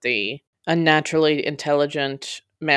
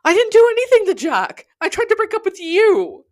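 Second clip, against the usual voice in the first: much higher and louder, throat-tearing yell; hysterical scream; 9 dB louder than usual. A narrator's reading. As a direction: scream it at the top of the voice. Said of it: "rising to a hysterical pitch it had hardly ever known".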